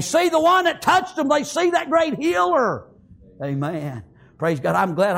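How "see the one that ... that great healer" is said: high, sustained pulpit fervour; full-force preaching. Acted. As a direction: preach it in driving, high-pitched waves.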